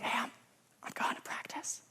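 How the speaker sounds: hushed whisper at the bedside; whispering to mom in the dark. Retold or imitated. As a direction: breathe it so dad stays asleep. Tiptoeing in, not waking dad.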